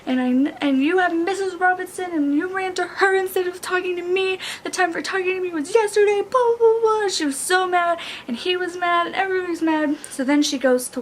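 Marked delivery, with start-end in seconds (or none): 0.1-7.1 higher-pitched mock quarrel rant; imitated indignant complaining; acted arguing. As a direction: rattle off a retold argument, pitched up. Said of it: "they get in a big fight".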